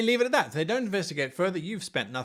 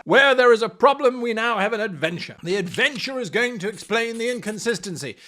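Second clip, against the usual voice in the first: normal talking voice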